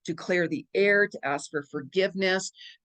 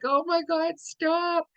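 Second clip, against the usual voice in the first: high-pitched pleading, acting out a laughing fit; squealed mock protest; retold helpless laughter; 9 semitones higher, slower than usual. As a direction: re-enact begging for mercy while tickled with laughter. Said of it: "I'd be laughing, and it was like".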